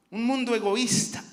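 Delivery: ordinary talk, nothing marked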